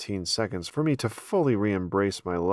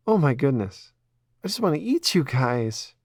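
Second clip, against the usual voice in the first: put-on higher, louder gushing girl voice over kittens; Ella gushing; 6 semitones higher, 3 dB louder than usual. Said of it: "She was officially melting".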